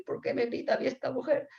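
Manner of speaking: high, hurt little complaint; mock crestfallen. Fruit seller shouted at by concierge, mock crestfallen. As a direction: play the wounded fruit seller.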